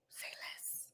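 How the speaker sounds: breathy whisper, barely voiced; whispered aside. Real, not acted. Whispering a sly aside about [name]. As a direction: drop to a secret whisper.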